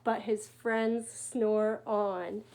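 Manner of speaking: very slow closing lines; slow right down to finish; sleepy final refrain; slow ending. A narrator's reading.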